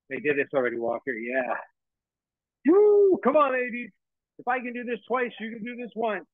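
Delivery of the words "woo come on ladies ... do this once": high, loud cheering coach; hype coach. Real, not acted. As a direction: pump up the class. Coach hyping class.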